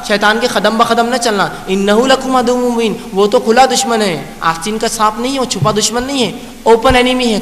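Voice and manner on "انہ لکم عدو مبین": slow, measured Arabic chant; تلاوت کا انداز; Quran recitation. Acted. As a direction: recite the verse with reverent care. Verse recited again.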